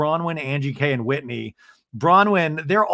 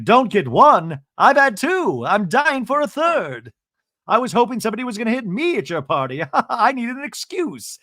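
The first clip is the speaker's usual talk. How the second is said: high, mock-dramatic quip; hammed-up [name]; 8 semitones higher, 3 dB louder than usual. [name] joking to the women, high mock drama. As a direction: camp it up like a diva.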